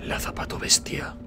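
slow, hushed whisper of a monster's name; ominous whisper. Pretend. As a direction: whisper it like a creepy legend. (susurro lento y siniestro)

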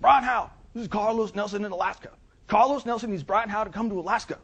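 high, loud booming caller; Carlos Nelson. Retold or imitated. Client phones consultant from Alaska, hammed-up high and loud. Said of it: "penchant for talking in the third person".

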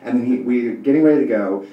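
usual speaking voice

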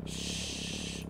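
very soft, long shh; soft shush. Real, not acted. Player putting the baby to sleep, soft shush. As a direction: gently hush a sleeper.

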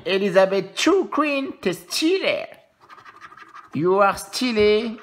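high, loud mock praise for the coin; playful gush. Streamer hyping up a coin to his viewers, high and loud. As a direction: gush over the queen on the coin.

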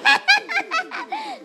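squeaky high giggle at own joke; real high-pitched giggle. Real, not acted. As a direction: let out a high burst of laughter.

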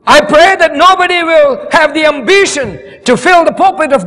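rally the congregation to keep fighting; fiery preaching. Acted. Preaching at full force.

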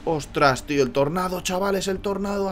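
high, loud alarmed cry; excited alarm. Real, not acted. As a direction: sound the alarm, half laughing. Tornado hits the town. (gritando alarmado)

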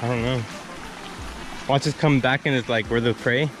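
ordinary delivery

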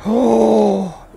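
high startled cry, then a drawn-out curse; jolted, dragging out the curse. Real, not acted. Caught by the animatronic.

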